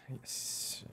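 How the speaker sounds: breathy secret whisper. Real, not acted. Streamer answering chat with a playful whispered yes.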